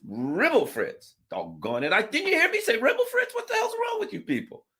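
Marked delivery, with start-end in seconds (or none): 0.0-1.1 slow mumbled answer. Retold or imitated. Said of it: "You understand the charges?". 1.9-4.7 much higher, louder, hammed-up indignation; indignant mock outburst. Mock Biden loses his temper.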